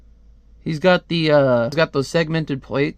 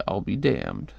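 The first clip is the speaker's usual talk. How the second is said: lightly voiced low, soft gruff hero; a low, understated comic-book hero impression; Hellboy voice; 7 semitones lower, 6 dB quieter, slower than usual. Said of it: "my Hellboy voice".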